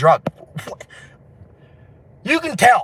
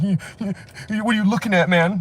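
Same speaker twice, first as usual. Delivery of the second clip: stammering, higher challenge; mock belligerent drunk; 7 semitones higher than usual. Drunk squaring up to best friend, mock belligerent. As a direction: pick a fight, lightly acted. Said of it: "They try to fight their, like, best friends".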